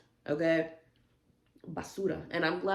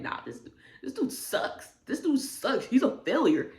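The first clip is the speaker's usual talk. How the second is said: high-pitched mocking dismissal; mock put-down; 7 semitones higher, 7 dB louder than usual. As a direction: imitate a hater, higher and louder. Mocks the poster's blunt put-down.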